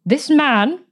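a lightly voiced harsh aunt. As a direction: point at him with a hint of harshness. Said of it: "in her harsh, grating voice".

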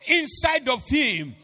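fervent sermon climb; preaching at full force. Acted.

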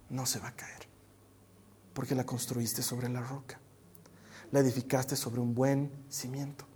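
soft, low, reassuring sermon; hushed preaching. Acted. Preacher assuring listeners their house will stand, hushed preaching. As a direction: speak gently with long pauses.

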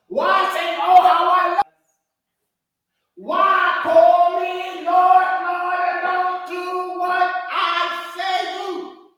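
fervent preacher's shout; preaching at full force. Acted. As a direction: hammer the question home.

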